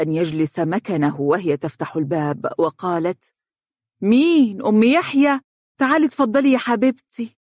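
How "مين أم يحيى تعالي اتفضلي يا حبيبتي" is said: high, raised-voice greeting at the door; pleasantly surprised, high-pitched hostess; surprised welcome. Acted. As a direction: call out who's there, then welcome warmly. Mother greets neighbour at the door.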